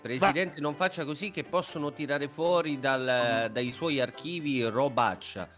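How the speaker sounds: ordinary talk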